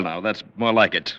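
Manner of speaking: usual speaking voice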